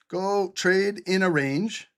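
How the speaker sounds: slow, drawn-out mocking permission; teasing a reckless viewer; sarcastic mock advice. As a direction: dangle the bad advice slowly. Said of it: "Let's give him his trade".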